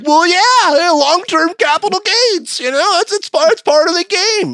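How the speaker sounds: high, breezy mock voice; mock know-it-all. People telling him taxes are part of the game, high and breezy. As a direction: shrug it off in a high voice. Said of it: "everyone I've talked to is like".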